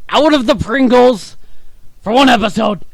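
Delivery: loud, high teasing outburst; teasing shout. Real, not acted. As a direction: call him out loudly, mock outrage. Teasing friend about Pringles.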